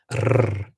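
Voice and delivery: a trilled "r" on the tongue; rolled R. Shown as a demonstration. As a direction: roll the "r" in a quick trill. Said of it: "the r type sounds".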